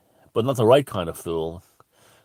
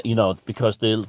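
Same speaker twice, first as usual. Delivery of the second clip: normal talking voice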